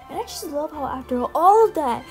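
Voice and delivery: normal talking voice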